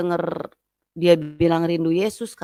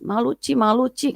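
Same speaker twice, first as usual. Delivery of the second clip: high, repeated embarrassed plea; lightly acted weepy voice; tearful, retold; 6 semitones higher than usual. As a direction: imitate her crying, pitched up. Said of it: "dia nangis, dia nangis".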